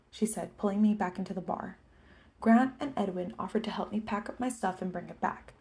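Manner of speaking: ordinary delivery, nothing marked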